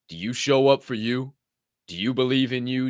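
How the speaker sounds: ordinary delivery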